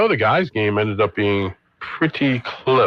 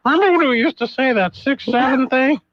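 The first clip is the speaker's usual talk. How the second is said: high, far voice of a grown-up student; future student voice; 13 semitones higher than usual. Pretend. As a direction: mimic a puzzled former student looking back. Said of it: "they'll be like".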